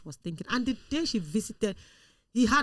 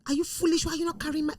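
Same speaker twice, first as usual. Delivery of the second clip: higher-pitched mock telling-off; imitated scolding; 8 semitones higher, faster than usual. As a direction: act out a boss berating the housemaid. Preacher scolding her housemaid, acted out.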